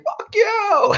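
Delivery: high-pitched crazed mock yell; hammed-up madman's outburst; raving madman. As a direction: yell like someone being dragged away. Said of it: "pulling me out like a madman saying".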